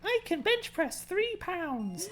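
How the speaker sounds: hammed-up high-pitched greeting; mock feminine voice. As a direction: squeak it out like a hulking man with a dainty voice. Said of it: "some really feminine, high-pitched voice".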